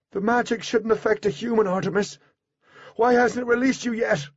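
high-pitched, urgent protest; alarmed questioning. A narrator's reading. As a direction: call out in worry and confusion. Alarmed question to trapped Artemis.

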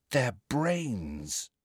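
soft, falling statement on "brains"; hushed, odd tone, "brains" falling; odd-sounding. A narrator's reading. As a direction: state "brains" quietly, as an uneasy discovery. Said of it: "She sounded odd".

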